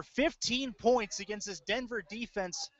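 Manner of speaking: usual speaking voice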